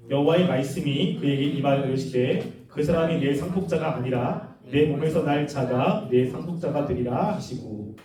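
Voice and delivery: low, measured reading aloud; scripture reading. Real, not acted. Preacher leads the congregation in Genesis fifteen, reading aloud.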